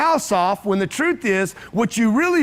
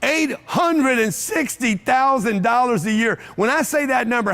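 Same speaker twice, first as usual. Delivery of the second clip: ordinary talk, nothing marked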